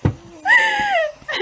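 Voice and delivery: loud laugh, high and sudden; high burst of laughter. Real, not acted. Laughs at own struggle.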